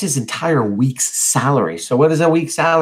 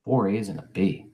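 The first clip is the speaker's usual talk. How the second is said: low, subdued, softer reading; softly; 4 semitones lower, 7 dB quieter than usual. A narrator's reading. Brother reading report card after silence, softly. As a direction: soften right down, humbled. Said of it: "Stanley softly".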